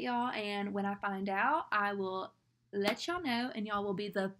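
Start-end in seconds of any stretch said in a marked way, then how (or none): none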